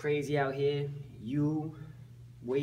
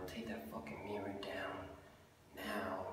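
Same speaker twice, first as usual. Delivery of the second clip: whispered; 5 semitones lower, 11 dB quieter than usual. Acted. Catchphrase muttered again.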